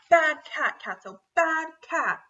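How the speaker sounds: loud, high shout, slowed on the last words; scolding shout. A narrator's reading. Lana scolding Catso the cat, loud shout. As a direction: yell at the naughty cat. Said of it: "he heard her shout".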